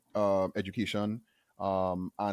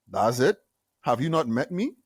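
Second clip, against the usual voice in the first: louder, swaggering mock arrogance; mock cocky brag; 4 semitones higher, 6 dB louder than usual. Joking back at the senior manager, louder mock swagger. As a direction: ham up a playful who-do-you-think-I-am. Mock boastful retort in meeting.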